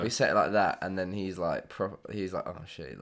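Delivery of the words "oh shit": muttered under the breath; a startled hushed curse; panicked teacher. Retold or imitated. Teacher hears the hidden remark, mutters in panic. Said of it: "skitzing out".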